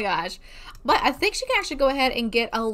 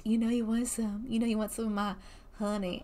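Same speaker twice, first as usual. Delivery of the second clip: low, much softer teasing offer; smooth flirt; 3 semitones lower, 8 dB quieter than usual. Retold or imitated. Imagining the dad sim offering honey, in a low soft flirty voice. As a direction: purr it like a sly flirt. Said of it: "the type that would be like".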